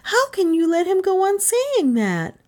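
put-on high, pleading girl; dismayed cry. A narrator's reading. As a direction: reproach him in a high child's voice. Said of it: "Gentian cried".

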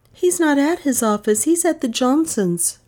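usual speaking voice

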